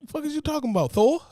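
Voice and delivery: high, rising "Asgard?" in mock disbelief; incredulous. Retelling his thoughts on the date, high mock disbelief. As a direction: act like she's lost her mind. Retold reaction to date's phrase.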